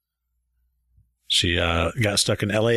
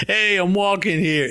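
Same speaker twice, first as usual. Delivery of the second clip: put-on New York accent; 9 semitones higher than usual. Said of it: "and he was like".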